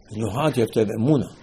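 ordinary talk, nothing marked

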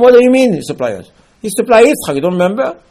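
high, loud disbelieving outburst; incredulous retort. Acted. Moshe rebuking the people who doubt Hashem, high and loud disbelief. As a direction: throw the question back at them in disbelief.

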